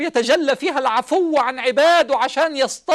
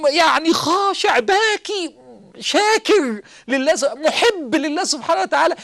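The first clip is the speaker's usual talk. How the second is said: higher, slow, voice breaking into a cry; choked with tears; 6 semitones higher, slower than usual. Real, not acted. Preacher moved to tears describing the humble worshipper, crying. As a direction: let the tears catch the words.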